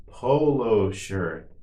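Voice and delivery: drawn-out word for learners; slow model. Shown as a demonstration. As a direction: say it slowly and evenly for repetition. Teacher models word for repetition.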